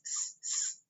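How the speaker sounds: long unvoiced s hiss; hissed s sound. Shown as a demonstration. Teacher sounding out the s for the class. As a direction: hiss the s like a snake. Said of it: "all you hear is s".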